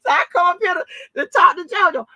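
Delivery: high, loud, excited holler. Real, not acted. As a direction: holler it out laughing. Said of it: "I'm hollering".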